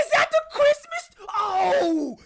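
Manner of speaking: very high, loud shout; acted outburst. Brother exclaiming over Christmas movie, high shouted outburst. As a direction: cry out at the top of the range.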